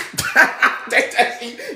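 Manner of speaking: high giggling laugh; amused laugh. Real, not acted. Host laughing after taunting critics, high giggle. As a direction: laugh at your own taunt.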